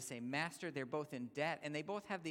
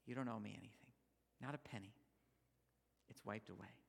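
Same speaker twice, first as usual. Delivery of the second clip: softer, tender release of the debt; gentle forgiveness; 4 semitones lower, 9 dB quieter, faster than usual. Retold or imitated. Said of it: "the master says".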